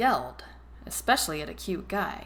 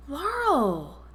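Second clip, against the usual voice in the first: high surprised call of her name; cry of surprise; 8 semitones higher than usual. A narrator's reading. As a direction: Daphne sees her friend sobbing, high surprised cry. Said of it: "Daphne cried in surprise".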